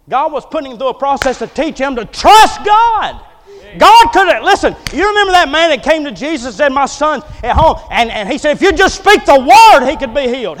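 loud, high, driving sermon voice; full-force preaching. Acted. Preacher driving home God's power to the church, loud and high. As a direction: preach it hard to the congregation.

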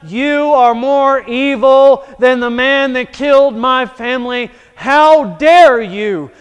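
loud, furious accusation; a grieving father rages at the judge, loud; accusing outburst. Acted. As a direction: point and condemn the judge. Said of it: "point your finger at that judge and say".

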